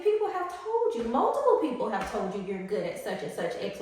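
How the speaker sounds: ordinary talk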